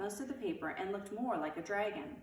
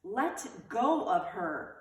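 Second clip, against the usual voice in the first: slow, firm, louder demand; angry; 5 dB louder, much slower than usual. A narrator's reading. Beezus scolding Wayne, angry. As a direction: Beezus snapping at Wayne to let go. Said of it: "said Beezus angrily".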